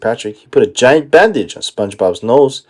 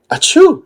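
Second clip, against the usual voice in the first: explosive sneeze; 14 semitones higher than usual. Acted.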